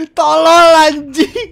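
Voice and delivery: loud, slow, crying curse; frustrated wail. Real, not acted. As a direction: wail it out in despair. Wailing at own mistake.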